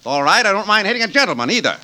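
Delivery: irritated retort. Acted. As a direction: snap back, ready to fight. Bum retorts to threat.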